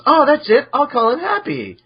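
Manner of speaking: light up with the idea, pitched high; mock eureka.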